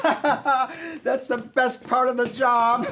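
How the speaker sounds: laughing, slow and gleeful. Acted. Top boss laughing at the dancing underling, slow. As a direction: savor the cruelty with a laugh.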